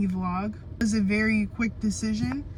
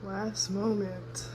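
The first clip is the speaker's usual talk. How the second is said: slow, soft wistful echo; slow and quiet; 7 dB quieter, much slower than usual. Real, not acted. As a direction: let it hang, slowly and softly. Farewell to empty bedroom.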